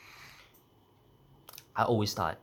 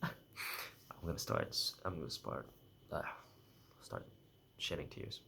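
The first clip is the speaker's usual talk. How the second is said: tearing up; 3 semitones lower, 8 dB quieter, slower than usual. Real, not acted. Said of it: "for sure I'm gonna cry".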